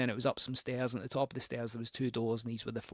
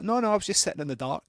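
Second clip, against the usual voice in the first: odd woman at the door; 7 semitones higher, 7 dB louder, faster than usual. Retold or imitated. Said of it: "And she's like".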